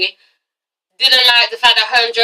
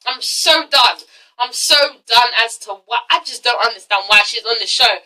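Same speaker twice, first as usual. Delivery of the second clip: ordinary talk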